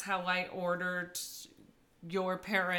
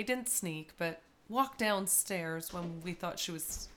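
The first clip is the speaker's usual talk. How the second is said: ordinary delivery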